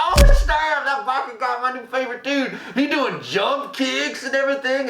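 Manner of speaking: imitating an excited viewer; excited fan. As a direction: gush about your new favorite. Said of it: "You're like".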